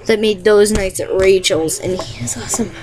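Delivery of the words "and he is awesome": normal talking voice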